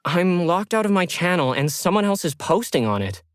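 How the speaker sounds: anxious blurt to the agent. Acted. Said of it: "Lauren blurted out".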